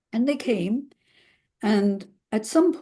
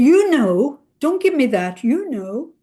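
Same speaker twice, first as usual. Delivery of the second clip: angry retort; 5 semitones higher, 6 dB louder than usual. Retold or imitated. Husband snapping back at wife.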